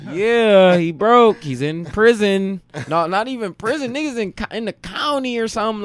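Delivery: loud and high mocking rant; mocking outburst. Telling friends the cellmate is broke, loud mocking.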